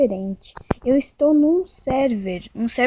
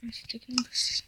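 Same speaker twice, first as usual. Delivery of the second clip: whispered muttering; 3 semitones lower, faster than usual. Real, not acted. Muttering while sorting inventory.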